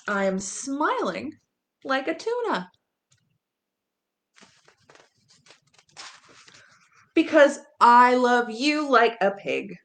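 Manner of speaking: playful picture-book reading with pig oinks and a mock gasp; reading to children. A narrator's reading. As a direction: ham it up for the little listeners.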